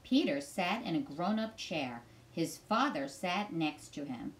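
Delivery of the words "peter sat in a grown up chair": usual speaking voice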